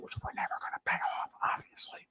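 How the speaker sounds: hushed sarcastic whisper; sarcastic whisper aside. Real, not acted. Sarcastic aside in the middle of a rant.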